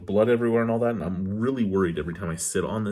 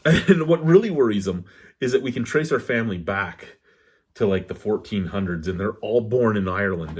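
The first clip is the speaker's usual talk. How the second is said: ordinary talk, nothing marked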